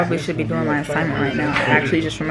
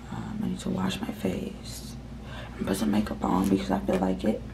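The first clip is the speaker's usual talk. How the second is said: whispering; 10 semitones lower, 5 dB quieter than usual. Real, not acted. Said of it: "I'm whispering 'cause".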